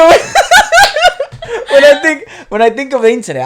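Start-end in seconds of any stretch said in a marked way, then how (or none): 0.1-3.4 laughing. Real, not acted. Laughing while teasing her friend.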